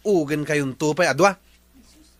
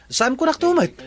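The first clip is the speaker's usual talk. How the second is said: sharp, much higher and louder challenge; indignant retort; 11 semitones higher, 3 dB louder than usual. Acted. Engineer scoffs at the tanod in a high indignant retort. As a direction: fire it back in outrage.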